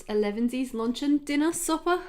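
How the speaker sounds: badly faked Scottish, building through the list; bad Scottish accent. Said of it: "I can't do a Scottish accent".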